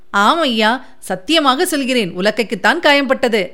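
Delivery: plain reading voice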